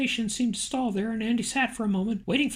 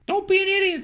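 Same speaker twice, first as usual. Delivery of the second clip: a much higher, louder sharp snap; snapping; 8 semitones higher, 6 dB louder than usual. A narrator's reading. The boss dismissing Andy's naive question, snapping loudly. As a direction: cut him off with a scornful bark. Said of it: "the boss snapped".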